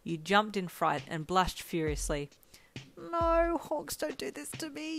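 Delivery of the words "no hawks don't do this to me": very high, drawn-out mock screech; hammed-up inner shriek of dismay; internal screech. A narrator's reading. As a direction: wail it in high-pitched panic. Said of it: "you screeched internally".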